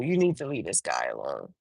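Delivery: a cop's low, hushed warning. Retold or imitated. Cop quietly warning the girlfriend, low and grave. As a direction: drop the voice way down and advise her gravely.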